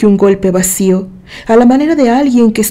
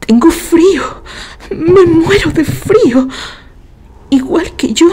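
high, trembling, teeth-chattering voice; freezing, higher-pitched and quivering; shivering with cold; 7 semitones higher than usual. A narrator's reading. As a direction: shiver through the words. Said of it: "se oyó el castañeo de los dientes".

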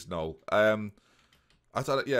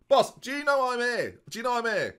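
high, raised voice to the boss; shouting player; 12 semitones higher, 3 dB louder than usual. Acted. Benched player pestering his boss, shouting high. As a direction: call out high to get noticed. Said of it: "Why are you shouting?".

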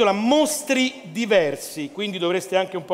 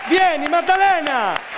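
slow, loud call across the hall; 8 semitones higher, 4 dB louder, slower than usual. Real, not acted. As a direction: call her up to the stage, slowly. Chiama l'artista a gran voce. (chiamata lenta e forte verso la sala)

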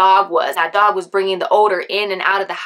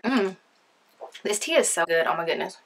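usual speaking voice

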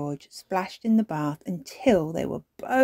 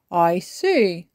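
louder grandfather voice, little raised in pitch; grandpa responding; 7 dB louder than usual. A narrator's reading. Grandpa hearing about the story deal, knowing. Grandpa answers granny and child.

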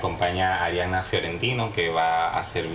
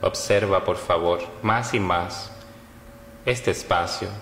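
measured meditation voice with long pauses; guided meditation. Acted. Yoga teacher leading listeners through Yoga Nidra, guided meditation. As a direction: guide them phrase by phrase, leaving long silences between.

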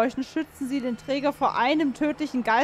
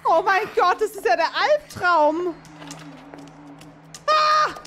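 panicked; 5 semitones higher, 3 dB louder than usual. Real, not acted. Nightmare monster, panicking.

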